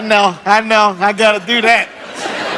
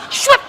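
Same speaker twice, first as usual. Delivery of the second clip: very high swish noise; a quick high swipe sound; whoosh sound. Retold or imitated. Money swept away sound.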